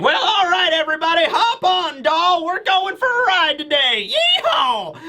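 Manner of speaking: high-pitched southern cowgirl holler; Bluegrass, southern accent. Acted. Bluegrass rallying riders onto a sea giraffe, high southern accent. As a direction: whoop it up in a high southern twang, rising to a yeehaw. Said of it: "I'm just gonna give her a southern accent".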